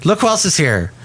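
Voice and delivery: usual speaking voice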